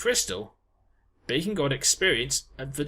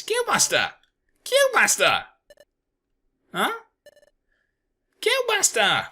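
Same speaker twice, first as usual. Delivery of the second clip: louder, much higher calls; calling out; 4 dB louder than usual. Acted. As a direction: shout to wake a sleeper. Calling out to wake boss.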